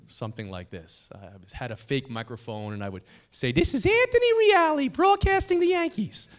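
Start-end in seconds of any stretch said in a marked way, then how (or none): 3.5-6.3 put-on very high squeaky child announcer voice; tiny high-pitched broadcaster impression; little-kid sportscaster. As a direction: announce it like a five-year-old with a fake microphone. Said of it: "that's what I sounded like".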